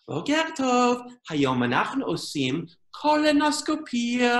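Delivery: put-on sing-song teacher talking to small children; adorable teacher voice. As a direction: make it sound adorable, drawing out the last word. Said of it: "could say anything and make it sound adorable".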